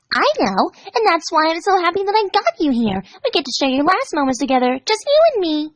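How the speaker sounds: high, giddy and sing-song; sweetly menacing Pinkie. Acted. Pinkie happily telling Dash she'll share her last moments. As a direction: delight in it like a party.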